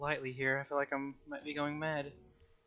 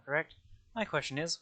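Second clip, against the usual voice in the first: usual speaking voice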